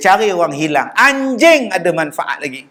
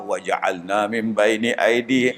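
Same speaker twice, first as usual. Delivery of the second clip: murmured prayer; 8 semitones lower, 6 dB quieter than usual. Retold or imitated. Reciting a prayer verse.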